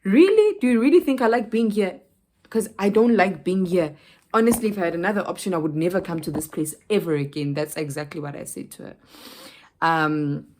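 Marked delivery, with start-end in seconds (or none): 0.0-2.0 high, loud indignant comeback; snapping back at the rude clerk, high and loud; indignant retort. Retold or imitated. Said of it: "I'm like". 2.5-7.5 fed up. Real, not acted. Still heated about the clerk.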